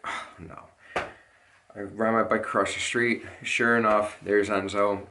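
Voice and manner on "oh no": whispered "Oh, no." under the breath; dread. Retold or imitated. Jumping on the bike, hearing the crash, whispered dread. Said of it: "and I'm like".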